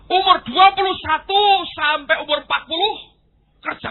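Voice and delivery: high, loud emphatic announcement; preaching loudly. Acted. Preacher loudly announces the hard years of life. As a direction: build it to a loud point.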